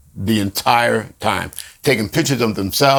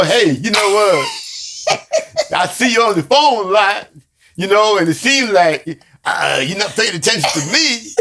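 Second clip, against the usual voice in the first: imitating her gentle, smiling approach; hammed-up sweet girlfriend voice; mock sweet version; 8 semitones higher than usual. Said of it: "There probably wouldn't be much smile".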